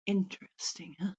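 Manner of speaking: soft, voiceless interesting; hushed knowing aside; whispered aside. Real, not acted. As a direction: whisper it like a secret. Musing aside to viewers.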